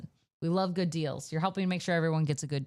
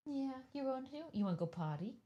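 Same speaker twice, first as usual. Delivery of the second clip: pet talk; 5 semitones higher, 11 dB quieter, faster than usual. Real, not acted. Talking sweetly to the dog.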